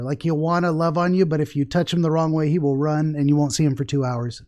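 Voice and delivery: normal talking voice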